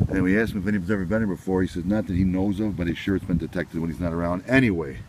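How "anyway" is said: ordinary talk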